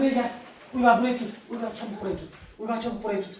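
calling out orders. Real, not acted. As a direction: call the helper over urgently. Calling son to hold the tent.

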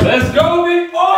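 high, loud cheering; hype shout. Real, not acted. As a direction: pump him up loudly. Hyping friend into cold water.

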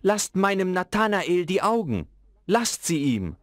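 father begging for his son's eyes; pleading call. A narrator's reading. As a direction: beg the master to spare the boy.

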